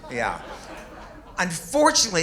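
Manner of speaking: ordinary delivery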